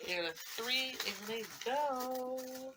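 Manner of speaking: quiet suspense countdown; hushed countdown. Real, not acted. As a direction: hold the breath as you count.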